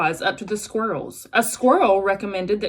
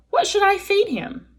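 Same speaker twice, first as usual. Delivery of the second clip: high robot voice; 12 semitones higher than usual. Acted. Robot asks.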